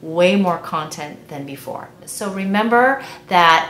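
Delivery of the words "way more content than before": ordinary delivery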